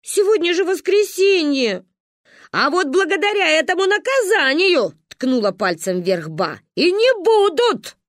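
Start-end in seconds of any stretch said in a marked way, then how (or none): 0.0-1.8 обиженно. Acted. Обида Каринки. 2.5-4.9 громко и высоко, с упрёком; ткнуть в виноватую; сердито. Acted. Ба тычет вверх. 6.8-8.0 громко, медленно, с вызовом; крикнуть сверху назло; отозвалась. Acted. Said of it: "отозвалась Манька".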